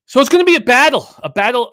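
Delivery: booming, fast and high, rallying the audience; hyped-up battle cry. Real, not acted. Host rallying listeners for a long betting show, excited shout. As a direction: shout it like a hype man opening the show.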